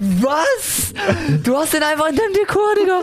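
high-pitched, stunned outburst; real incredulous yelp, pitch shooting up; shocked disbelief. Real, not acted. Podcaster learning of co-host's Ali picture, shocked disbelief.

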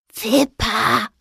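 breathy whispered name. A narrator's reading. Ghost Anton whispering to the mouse. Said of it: "hauchte es".

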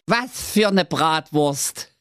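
high cackling laugh into a slow sneer; mocking giant laugh. A narrator's reading. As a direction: laugh at the prince. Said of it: "lachte siebenstimmig".

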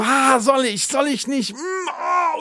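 high, hammed-up dithering over the buzzer; mock judge dithering. Mocking a TV judge to friends, high hammed-up dithering. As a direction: play a TV judge torn over pressing, milking the moment. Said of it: "und dann so".

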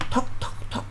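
breathy whisper of a soft knock. Retold or imitated. Boy tells children about a quiet knock at night, whispered. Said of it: "Dann wieder ganz leise".

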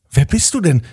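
put-on a boy excited to meet the talking truck; excited question. A narrator's reading. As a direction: ask it all worked up. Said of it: "fragte Emilio ganz aufgeregt".

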